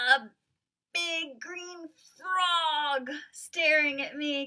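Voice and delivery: high, loud, slow dramatic reveal; excited reveal. Acted. Teacher dramatically revealing the frog to the class. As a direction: make the big moment pop.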